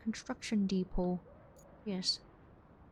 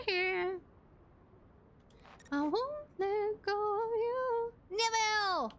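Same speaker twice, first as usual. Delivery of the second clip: creepy clingy voice; 10 semitones higher, slower than usual. Pretend.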